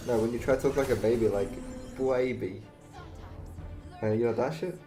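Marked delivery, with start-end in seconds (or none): none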